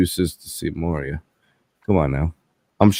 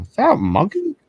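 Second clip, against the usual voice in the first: incredulous; 12 semitones higher than usual. Retold or imitated. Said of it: "I'm like".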